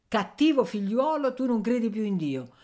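voce più alta, da donna che sgrida; rimprovero affettuoso. Acted. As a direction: sgrida il figliolo a voce acuta. Anziana rimprovera il figlio. (rimprovero recitato, acuto)

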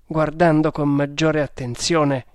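usual speaking voice

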